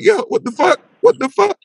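high-pitched, comic freak-out; mock alarm. Man feels himself falling backward, high mock alarm.

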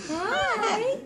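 normal talking voice

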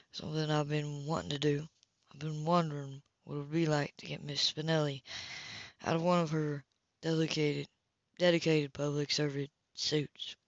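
soft, low, seductive; seductive murmur. Acted. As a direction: lean in and tempt her quietly.

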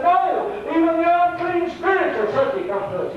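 high-pitched, fervent preacher quoting elated disciples; exultant report. Retold or imitated. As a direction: cry it out high, as amazed followers reporting back. Preacher voices the returning disciples' report.

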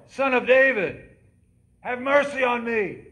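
loud, high, slow desperate cry. Retold or imitated. Blind beggar crying over the crowd, loud slow plea. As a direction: plead at the top of your voice. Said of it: "he cried all the more".

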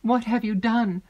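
high, loud, dismayed question; horrified. A narrator's reading. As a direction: cry out in dread of the answer. Heroine learns of a dreadful deed.

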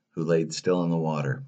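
ordinary delivery, nothing marked

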